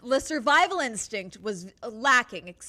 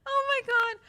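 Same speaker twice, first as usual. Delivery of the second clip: laughing; 14 semitones higher than usual. Real, not acted. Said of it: "I need to calm down".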